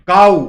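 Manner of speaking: काऊ in one loud beat; one-syllable demonstration. Shown as a demonstration. Teacher giving a one-syllable example, काऊ in one loud beat. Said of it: "एक syllable".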